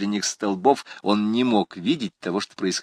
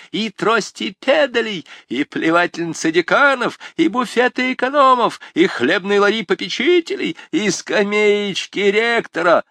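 gleeful listing; 11 semitones higher, 5 dB louder than usual. Acted. Students listing.

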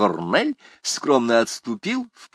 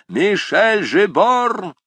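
loud, slow call of the name, higher; shout in the ear; 11 semitones higher, 6 dB louder, much slower than usual. A narrator's reading. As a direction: yell the name right into his ear. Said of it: "крикнул ему чуть не в самое ухо". (громкий крик прямо в ухо)

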